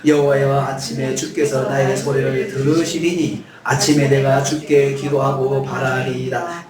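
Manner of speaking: slow, solemn reading of the verse; scripture reading. Shown as a demonstration. Preacher leading the congregation reading Psalm 5:3, solemn. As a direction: read it aloud with the congregation. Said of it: "같이 읽습니다".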